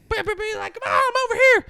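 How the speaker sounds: high squeaky shout across the lot; a hammed-up driver yelling to be seen; loud, very high put-on yell; mock shrill calling.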